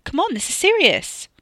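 high, loud urging; calling out. A narrator's reading. Katie hurries the boys along, calling out. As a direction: chivvy them from another room. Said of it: "Katie called from the living room".

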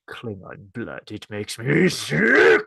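slow, hammed-up villain; Crin. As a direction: start hushed and low, swell into slow disgust. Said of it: "And then Crin's like".

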